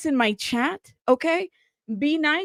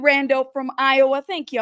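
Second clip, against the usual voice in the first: usual speaking voice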